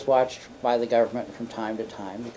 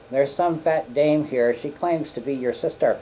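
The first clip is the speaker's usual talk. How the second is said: blunt secretary, louder; 3 semitones higher, 6 dB louder than usual. Retold or imitated. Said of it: "And he goes".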